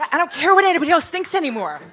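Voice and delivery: fast, high and loud burst of defiance; excited declaration. Real, not acted. Founder telling the panel audience she no longer cares, excited and fast. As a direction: let it spill out, free and fearless.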